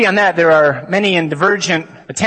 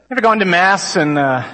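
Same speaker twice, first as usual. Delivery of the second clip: ordinary delivery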